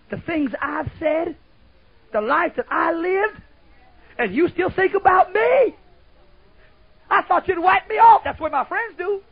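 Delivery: rising preaching plea. Acted. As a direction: build it into a high, pleading cry. Climbing plea, louder each line.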